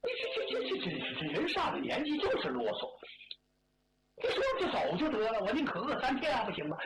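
exasperated stammer rushing into complaint; fed up. Acted. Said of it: "刘世昌烦了". (不耐烦地抱怨)

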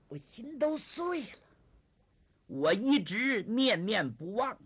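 slow, drawn-out heartfelt lament; storyteller's emotional reunion voice; acted heartbreak. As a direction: stretch each phrase with mild theatrical anguish. Zhang's emotional reunion with Yuchun.

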